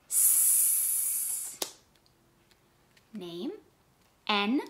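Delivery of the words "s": a steady hiss for S; s sound. Shown as a demonstration. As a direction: hiss a long voiceless S. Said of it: "S sound".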